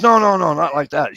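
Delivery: high, loud hurried protest; urgent denial. Retold or imitated. Wife quickly correcting the ER doctor, loud and high. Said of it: "She goes".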